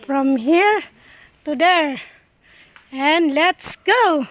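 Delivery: loud, slow, drawn-out announcement; rallying kickoff. Real, not acted. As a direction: call it out loudly to launch the trek. Announces the start of climb.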